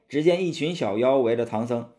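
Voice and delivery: plain reading voice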